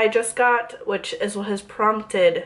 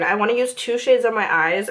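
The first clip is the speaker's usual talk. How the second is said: ordinary delivery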